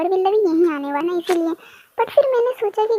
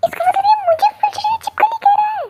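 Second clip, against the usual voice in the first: put-on a much higher, offended girl's voice; indignant girl; 12 semitones higher than usual. Girl overhearing the lizard insult, high and offended. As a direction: play the insulted young woman, pitched up.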